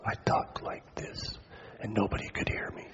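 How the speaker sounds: breathy unvoiced whisper, barely audible. Shown as a demonstration. Pastor showing the congregation his depressed whisper. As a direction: show how weak the voice had become. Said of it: "That's how I sounded".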